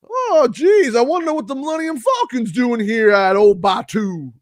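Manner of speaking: high, hammed-up character accent; role player's shifting accent. Park actor wondering about the Millennium Falcon, hammy accent. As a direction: overact a nosy local in a high voice. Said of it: "four accents during the course of it".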